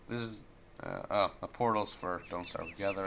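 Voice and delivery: usual speaking voice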